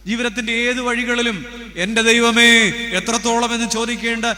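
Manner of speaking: loud, high fervent preaching; full-force sermon. Acted. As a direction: build the appeal over the crowd.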